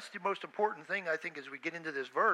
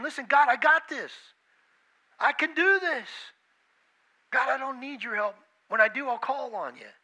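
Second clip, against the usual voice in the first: loud, higher cocky boast; boasting to God; 6 semitones higher, 8 dB louder than usual. Retold or imitated. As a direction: brush God off, full of yourself. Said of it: "you're boasting to God saying".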